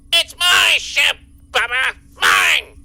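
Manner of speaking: loud, emphatic boss bark; forceful, punched-out declaration; possessive outburst. Acted. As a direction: lay loud claim to what is yours. Boss asserts ownership, signing off.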